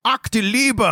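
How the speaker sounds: high-pitched mock exclamation; comic Nazi cry. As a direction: cry out like a toppling cartoon villain. Quoting a falling comic-book Nazi.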